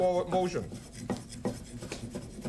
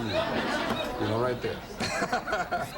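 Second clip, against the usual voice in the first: mock finger-loss joke; 7 semitones lower, much slower than usual. No, I'm just kidding.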